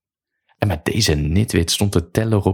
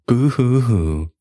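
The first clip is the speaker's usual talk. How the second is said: high, drawn-out fake boohoo; mock crying call; 5 semitones higher, slower than usual. A narrator's reading. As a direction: jeer after them with slow, pretend sobs. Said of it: "riep ik nog achter hem aan". (spottend nagedaan gehuil, hoog en langgerekt)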